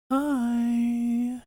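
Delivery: put-on very high, breathy-sounding blonde girl; airy blonde-girl hi. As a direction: squeak it like a ditzy blonde. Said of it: "you're like".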